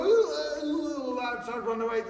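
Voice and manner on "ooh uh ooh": loud high wavering squeal; hammed-up fright at a dog; mock panicked reaction. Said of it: "don't go".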